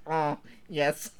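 mock diver voice in pleasure; weird scuba voice. Imagining a scuba diver having sex, weird voice. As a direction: groan it as if through diving gear. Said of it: "I imagine him having sex".

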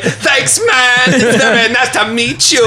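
very high, hammed-up star impression. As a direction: squeal it out as a terrible celebrity impression. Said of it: "nail your [name] impression".

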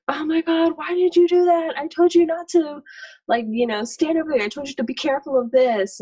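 put-on high, exasperated scolding of a toddler; mock scolding mom. Mom scolding her toddler over a spill, high exasperated mock scold.

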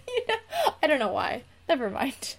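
normal talking voice

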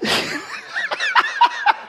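sudden high burst of laughter. Real, not acted. Pastor telling congregation a funny story, high laugh.

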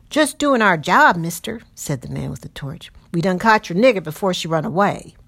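a rough man justifying himself to the owner; coarse patroller voice. Acted. Patroller answers the master.

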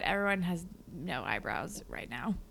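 soft unvoiced whisper; an eyebrow confession, whispered. Real, not acted. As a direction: whisper it like a secret. Whispered aside.

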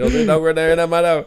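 a lighter take of the wired neighbour; lightly imitating the woman asking about her dog; lightly mocked frantic voice. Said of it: "quoting the woman again".

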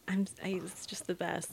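whispering. Real, not acted. Quiet aside.

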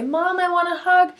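put-on high-pitched little kid asking Mom; lightly acted childlike plea, rising at the end; child reaching out. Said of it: "reach out and say".

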